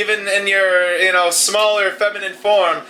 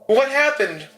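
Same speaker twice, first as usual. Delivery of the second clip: higher-pitched, confused nurse; bewildered nurse coming to; 5 semitones higher than usual. Acted. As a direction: ask in bewilderment as you come round. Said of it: "she says".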